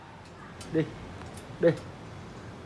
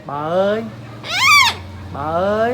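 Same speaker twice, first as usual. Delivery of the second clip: louder, higher call; modelling a word; 5 semitones higher, 7 dB louder, slower than usual. Shown as a demonstration. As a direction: call it clearly for the bird. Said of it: "Nói đàng hoàng".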